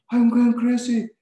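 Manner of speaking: high, loud, slow panicked wail; a man losing his grip, crying out; frantic panic. Retold or imitated. Passenger losing his mind in the moving truck, loud frantic wail. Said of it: "terus dia bilang gini".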